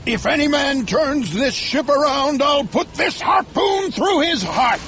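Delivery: high-pitched, menacing captain's threat; raging captain shouting over a storm; fierce shout. A narrator's reading. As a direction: threaten the whole crew fiercely. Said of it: "shouted Ahab fiercely".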